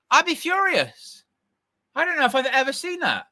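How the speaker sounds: can't believe what he's seeing; high-pitched disbelief; incredulous. Real, not acted. Reacting to six added minutes.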